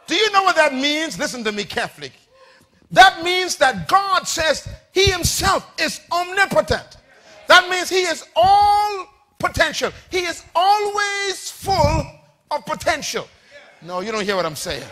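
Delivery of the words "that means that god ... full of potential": high, impassioned sermon cry; ringing preacher declamation; full-force preaching. Real, not acted. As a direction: proclaim it, stretching the key words.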